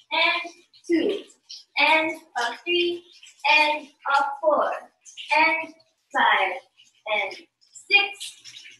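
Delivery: slow dance count, gaps after each beat; measured, spaced-out counting. Shown as a demonstration. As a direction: count the beats out slowly for learners. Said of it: "let's do it again slowly".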